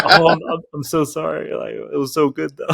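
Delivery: fake-sorry friend, not really sorry; mock apology.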